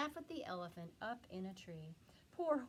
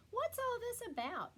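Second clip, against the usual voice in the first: very high excited shout; 13 semitones higher, 4 dB louder than usual. A narrator's reading. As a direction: call out in astonishment. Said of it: "people came shouting".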